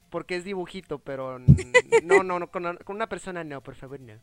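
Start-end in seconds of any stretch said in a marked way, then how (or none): none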